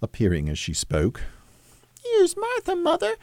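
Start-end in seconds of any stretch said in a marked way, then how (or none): 2.0-3.2 put-on very high falsetto child voice; young children crying out. A narrator's reading. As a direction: squeal it like little children, without extra volume. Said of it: "cried the two young Cratchits".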